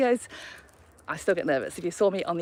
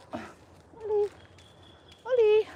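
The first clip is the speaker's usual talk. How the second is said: calling the dog; 14 semitones higher, much slower than usual. Real, not acted.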